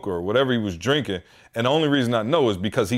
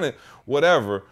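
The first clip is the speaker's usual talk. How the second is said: ordinary talk, nothing marked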